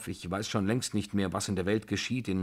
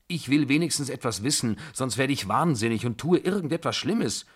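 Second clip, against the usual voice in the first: higher, louder, insistent pleading; agitated outburst; 4 semitones higher, 4 dB louder than usual. Acted. Narrator demands to know what is going on, agitated. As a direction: burst out, desperate for an explanation.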